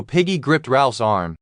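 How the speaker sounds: ordinary delivery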